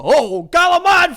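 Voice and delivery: loud, high, drawn-out Italian-American holler; dad's Italian-American. Retold or imitated. Imitating his dad saying food words, shouted Italian-American. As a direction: bellow it slowly in dialect Italian. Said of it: "My dad said all the".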